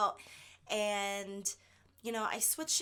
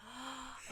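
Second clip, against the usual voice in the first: mock gasp; 13 dB quieter than usual. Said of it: "And I was like".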